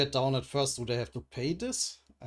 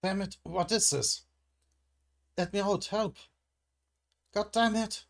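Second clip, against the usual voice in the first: panicked; 7 semitones higher than usual. Acted. Trapped hostage cries for help.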